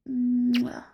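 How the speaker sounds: a close, smacking kiss; little kissing noise; kiss sound. Acted. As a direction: plant a kiss right by the mic. Kissing the listener.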